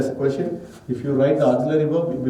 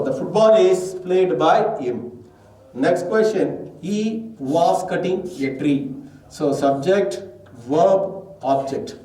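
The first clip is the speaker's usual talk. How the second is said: slow dictation; 3 semitones higher, slower than usual. Shown as a demonstration. Teacher reading example sentences slowly.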